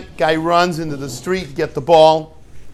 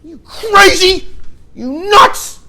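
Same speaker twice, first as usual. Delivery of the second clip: high, loud, slow furious scolding; scolding parent; 8 dB louder than usual. Retold or imitated. Parent who caught his kid scolding him, high, loud and furious. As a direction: let him have it like a frightened, angry father.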